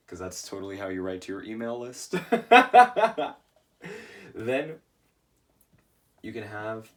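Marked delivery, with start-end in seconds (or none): 2.2-3.3 loud, high-pitched laughing burst; sudden loud laugh; real laugh. Real, not acted. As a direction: burst out laughing at your own joke. Laughs at own sign-off example.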